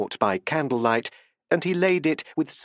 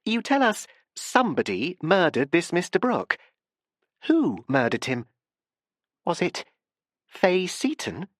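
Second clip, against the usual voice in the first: high, pressing question; a touch of nervous hurry; 5 semitones higher than usual. A narrator's reading. Barbara hurries to change the subject, a touch nervous. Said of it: "she hurried on".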